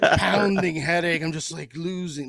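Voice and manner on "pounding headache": hungover drag. Real, not acted. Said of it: "extremely hungover".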